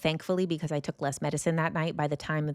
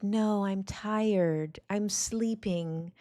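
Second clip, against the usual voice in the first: slow, higher, drowsy little cat voice; sleepy cat voice; 4 semitones higher, much slower than usual. Retold or imitated. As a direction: voice the cat as if it were half asleep. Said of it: "and it's like".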